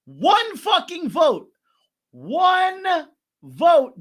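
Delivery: loud, high, drawn-out exclamation; emphatic shout. Real, not acted. Host announcing another one-vote decision, emphatic shouting. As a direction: holler each word in disbelief, stretching it out.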